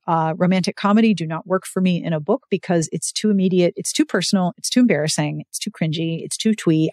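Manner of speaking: normal talking voice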